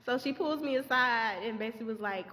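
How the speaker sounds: ordinary delivery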